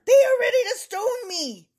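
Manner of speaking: high loud mock sob with laughter; hammed-up anguish, laughing; laughing wail. Moses pleading to God.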